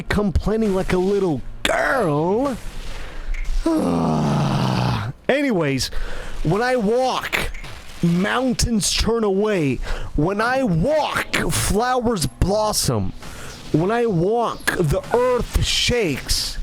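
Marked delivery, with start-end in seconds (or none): 2.6-5.2 long exasperated sigh; heavy sigh. Real, not acted. Fed up with his own complaining, long heavy sigh. 6.4-16.6 slow, grandly paced verse; poetry recital. Acted. Reciting his own boastful poem, slow and deliberate.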